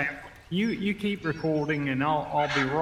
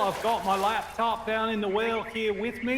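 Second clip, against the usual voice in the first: put-on Australian accent; 5 semitones higher, 4 dB louder than usual. Put-on Aussie accent throughout.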